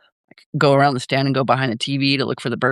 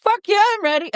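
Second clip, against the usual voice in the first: put-on high, squeaky old granny saying it; old grandma voice. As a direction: pitch it way up like a feisty grandma. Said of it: "the grandma says".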